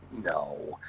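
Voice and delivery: retold inner disbelief, dropped low; thinking to oneself. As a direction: voice a private, disbelieving thought. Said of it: "I'm thinking to myself".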